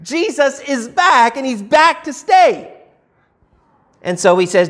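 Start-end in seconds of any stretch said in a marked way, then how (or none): 0.0-2.7 high, thrilled "Wow" then a slower, delighted line; excited disciples. Retold or imitated. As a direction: sound overjoyed that he's back for good. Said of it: "And they thought".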